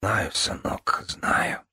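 soft, voiceless soothing; tender whisper. A narrator's reading. Lady comforting her grieving son, whispering. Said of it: "прошептала Вивиана".

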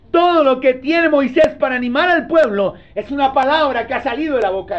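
impassioned preaching. Real, not acted. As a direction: preach it with full force, pitch held high. Preaches at full force. (predicación enérgica, en tono alto)

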